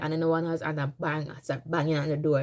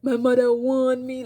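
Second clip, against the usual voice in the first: high, louder patois lament; Jamaican patois; 7 semitones higher, 6 dB louder, slower than usual. Retold or imitated. Crying to herself in Jamaican patois about her mother's warning. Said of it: "I start talking patois".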